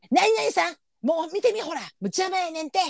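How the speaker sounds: high voiced chatty old woman, grumbling aside; high old lady gossip voice; elderly lady. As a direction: mimic an old lady muttering to her friend. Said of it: "とかさ".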